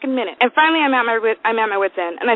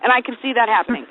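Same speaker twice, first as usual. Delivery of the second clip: ordinary talk, nothing marked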